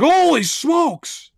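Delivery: high, loud drawn-out exclamation; reliving a shock, big and high-pitched; shocked dream outburst. Retold or imitated. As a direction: cry it out slowly in mock astonishment. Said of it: "And I was like".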